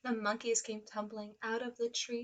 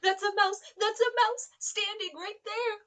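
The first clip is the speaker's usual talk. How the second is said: higher, louder frightened pleading; panicked elephant; 8 semitones higher, 7 dB louder than usual. Acted. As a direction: beg in panic, voice climbing.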